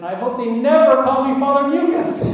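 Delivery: can't hold back the laugh on the joke; punchline broken up by his own laughter; laughing through the punchline. Real, not acted.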